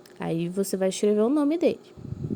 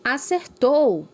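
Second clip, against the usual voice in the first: higher and louder, drawn out slowly; excited cheer; 6 semitones higher, 4 dB louder, much slower than usual. Shown as a demonstration. Teacher showing pupils how a happy cheer sounds. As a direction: cheer the right answer with delight. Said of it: "Essa frase foi de surpresa, de contentamento".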